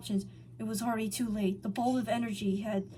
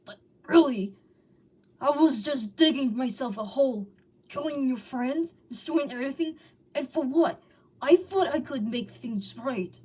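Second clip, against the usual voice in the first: loud, anguished confession; remorseful outburst; 3 semitones higher, 7 dB louder than usual. A narrator's reading. Sonic shouting his regret to the dying Hog. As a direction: cry out the guilt.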